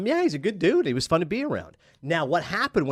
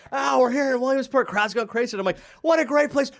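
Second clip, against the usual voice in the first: higher, louder hammed-up on-air announcer; mock broadcaster voice; 11 semitones higher, 3 dB louder than usual. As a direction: play the cheery TV host slipping in a pointed line. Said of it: "I just say".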